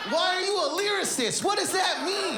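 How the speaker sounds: high, hammed-up bafflement; exasperated outcry. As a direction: cry out in comic exasperation. Acted exasperation at cryptic insult.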